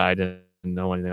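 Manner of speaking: normal talking voice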